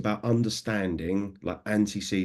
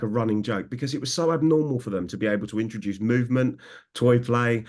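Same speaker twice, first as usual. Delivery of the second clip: ordinary talk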